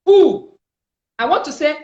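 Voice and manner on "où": "où" said high and loud, rounded oo; French où. Shown as a demonstration. Teacher modelling French for the class, "où" high. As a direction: sing out "où" like oo. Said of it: "you want to say where".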